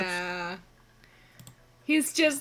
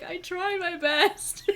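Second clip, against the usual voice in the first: puppy dog plea; 5 semitones higher, 6 dB quieter, slower than usual. Acted. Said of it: "puppy dog eyes".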